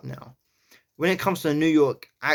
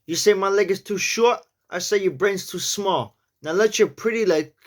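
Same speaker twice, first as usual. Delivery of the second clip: New York accent; 7 semitones higher than usual. Shown as a demonstration. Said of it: "let's give this a go".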